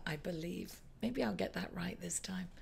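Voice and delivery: unsure whispered aside to herself; whispering. Real, not acted. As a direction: drop to a whisper, half to yourself. Unsure aside about on-screen info.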